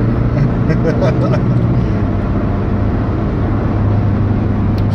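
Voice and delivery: hearty real laughter trailing on; long real laugh. Real, not acted. After the tipping lady, a long real laugh.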